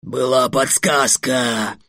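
loud, drawn-out outcry; indignant dragon howl; dragon's yell. A narrator's reading. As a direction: bellow it slowly in protest. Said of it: "завопил дракон".

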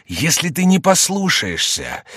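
high, slow ominous threat; menacing voice. A narrator's reading. Mysterious voice threatens the disobedient sinner, menacing. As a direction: warn with grave deliberation. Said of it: "приняв грозное выражение".

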